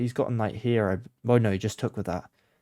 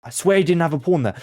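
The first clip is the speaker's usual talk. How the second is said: higher, louder outburst of disbelief; loud protest; 6 semitones higher, 7 dB louder, faster than usual. Real, not acted. Player caught out by a pawn, loud indignant protest. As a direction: protest it aloud, pitch jumping up.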